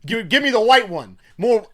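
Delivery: high, loud, greedy demands for wine; mock indulgent diner. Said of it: "she was like".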